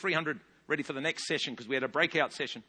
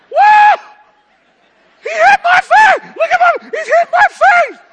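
much higher, very loud screeching mock scream; imitated woman's scream; 22 dB louder, slower than usual. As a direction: shriek it like the struck lady. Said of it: "She screamed like I've never heard".

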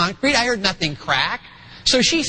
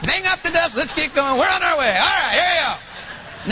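higher-pitched, hearty hurry-along; brisk dad imitation; 5 semitones higher than usual. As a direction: play a dad cheerfully brushing off a scrape. Acting a dad hurrying hurt kid along.

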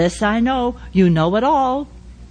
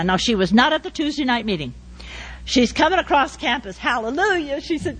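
ordinary talk, nothing marked

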